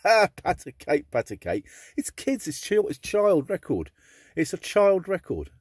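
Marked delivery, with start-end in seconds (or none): 0.0-1.1 slow, loud, amused reading of the title; drawn-out laughing read. Real, not acted. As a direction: chuckle it out, savouring the silliness. Reading nursery title off record.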